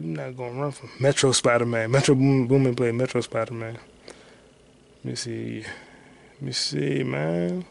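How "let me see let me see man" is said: very slow, stretched mutter; drawn out. Real, not acted. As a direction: stretch it out while waiting. Waiting on slow search.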